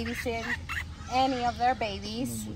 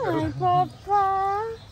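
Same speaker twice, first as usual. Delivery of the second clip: very slow, higher singsong hello; drawn-out sweet greeting; 4 semitones higher, much slower than usual. Real, not acted. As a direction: stretch the greeting out lovingly.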